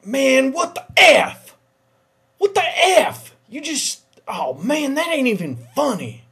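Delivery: high, loud startled outburst; loud high alarmed complaint; freaked-out shout. Acted. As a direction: yelp it in shock, high and loud. Freaked out by hidden bear.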